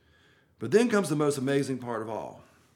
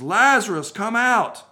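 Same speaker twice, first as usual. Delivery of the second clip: calling a name into the tomb, higher and louder; 8 semitones higher, 9 dB louder than usual. Acted. Jesus calls his dead friend out of the tomb, loud command. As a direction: summon him out with a loud, ringing call. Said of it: "in a loud voice".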